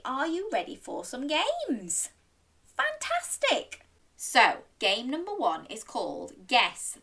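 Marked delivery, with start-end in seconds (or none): 2.8-3.9 very high, slow cheer; excited praise. Real, not acted. Storyteller pleased children want games, high excited praise. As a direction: praise with a high, delighted lift.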